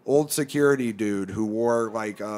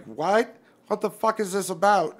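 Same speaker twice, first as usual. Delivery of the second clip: indignant disbelief; 8 semitones higher than usual. Retold or imitated. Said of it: "And I was like".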